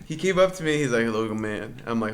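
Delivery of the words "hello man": low, hushed imitation of a strange man; creepy stranger. Imitating a strange coworker greeting him, low and hushed. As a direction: lower and soften to play the eerie coworker.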